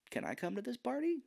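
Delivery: high, soft hopeful little question; eager mock plea. Acting as Billy, asking eagerly.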